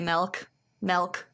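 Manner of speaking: "milk" with an E vowel, as "melk"; regional "melk". Shown as a demonstration. Host owning up to it, "milk" pronounced "melk". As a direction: say "milk" to rhyme with elk. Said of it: "I say milk".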